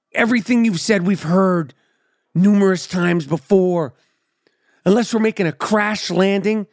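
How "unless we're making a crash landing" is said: normal talking voice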